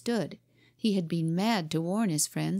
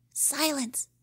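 put-on a boy's hissed order, higher but hushed; hissed command; 8 semitones higher than usual. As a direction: hiss it to the gang, playing captain. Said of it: "voice hiss out a word".

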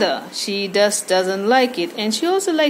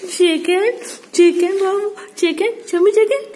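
coaxing pet voice; 7 semitones higher than usual. Real, not acted. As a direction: coax the pet, high and eager. Coaxing pet to shake hand.

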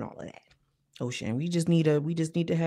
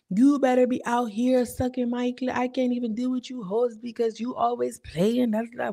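hammed-up diva madam; 6 semitones higher than usual. [name] going off on girls.

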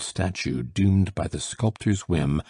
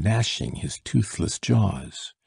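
ordinary delivery

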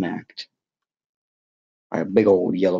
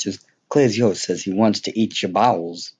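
ordinary talk, nothing marked

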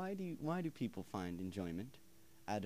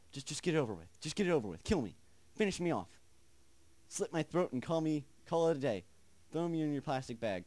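loud, raised-voice taunting plea; exasperated goading; 5 semitones higher, 6 dB louder than usual. Real, not acted. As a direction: dare it loudly to finish you. Begging game to end suspense.

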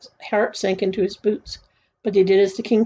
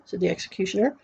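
ordinary delivery

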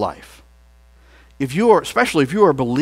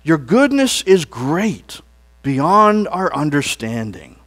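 leading praise; 2 semitones higher, 4 dB louder than usual. Shown as a demonstration. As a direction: lift the congregation's voices. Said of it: "Lord, you're slow to anger".

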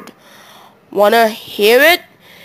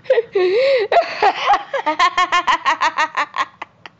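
high, extended laughing fit; 7 semitones higher, 5 dB quieter than usual. Real, not acted. After attempting the awkward girl voice, laughing fit. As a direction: burst into a long high laugh.

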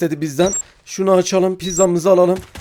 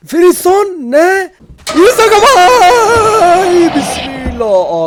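high, loud, stretched-out cry of fright; real terror at a jump scare; panicked scream; 14 semitones higher, 8 dB louder, much slower than usual. Real, not acted. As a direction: shriek it like you saw a monster. Terrified yelling at a game creature.

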